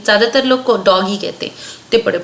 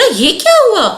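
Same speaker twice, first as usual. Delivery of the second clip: high, loud startled question; surprised; 11 semitones higher, 4 dB louder than usual. Acted. Children find the fallen chick, surprised. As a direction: gasp out the discovery. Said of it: "हैरान होके सोचा".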